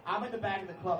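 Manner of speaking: normal talking voice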